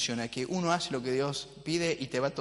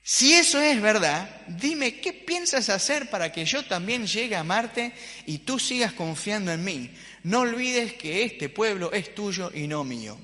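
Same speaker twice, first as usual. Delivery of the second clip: Moisés reclamando; 5 semitones higher, 4 dB louder than usual. Acted.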